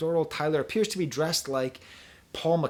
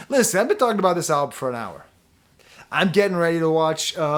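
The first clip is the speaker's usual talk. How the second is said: loud aside; 4 semitones higher, 9 dB louder than usual. Real, not acted. Sudden loud aside to viewers.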